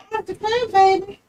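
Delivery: very high squeaky pretend baby voice; voicing the baby. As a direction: speak for the baby in a tiny high voice.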